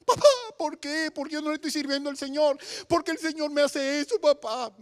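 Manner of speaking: high, soft crying voice of a daughter; acting out a weeping, wailing call home; imitated tearful pleading. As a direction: plead with papá through mock sobs.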